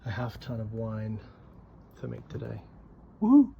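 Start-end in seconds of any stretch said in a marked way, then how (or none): 3.2-3.5 high happy whoop; cheering. Real, not acted. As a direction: let out a quick cheer. Cheering half ton of wine.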